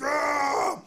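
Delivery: high, loud mock roar; short hammed-up battle cry; imitated charging roar. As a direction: roar like a comic attacker. Imitates slow charging roar again.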